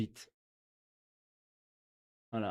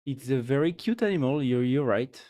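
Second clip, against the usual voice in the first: ordinary talk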